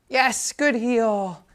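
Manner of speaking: slow, high drawn-out praise. Real, not acted. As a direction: stretch the praise out for the dog. Praising dog in training.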